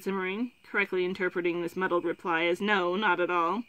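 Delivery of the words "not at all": plain reading voice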